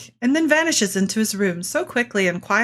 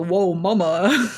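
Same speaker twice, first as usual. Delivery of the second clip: slow, laughing pickup line; mock smooth flirt; 3 semitones lower, slower than usual. Telling the guy to hit on her, slow slick flirt. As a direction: lay on a slick, slow "Whoa, mama.". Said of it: "hit him with the".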